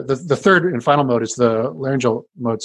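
usual speaking voice

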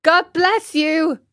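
high, loud little puppet voice; high-pitched wooden boy; puppet boy Pinocchio. Acted. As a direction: pipe up slowly like a polite puppet. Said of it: "said Pinocchio".

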